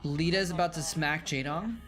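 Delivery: normal talking voice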